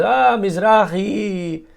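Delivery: slow Spanish Israeli greeting on Ah, Mizrahi; a hint of a Spanish Israeli accent. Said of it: "he had the Spanish Israeli accent".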